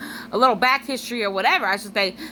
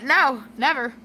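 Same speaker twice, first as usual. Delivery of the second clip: ordinary delivery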